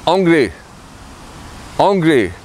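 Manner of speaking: anglais, said ahn-gleh with nasal an; French pronunciation. Shown as a demonstration. Teacher models anglais for learners, careful French. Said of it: "learn how to say English".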